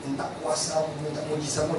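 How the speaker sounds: softly murmured prayer to God; quiet prayer. Retold or imitated. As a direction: pray under your breath, hushed. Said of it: "Saya berdoa".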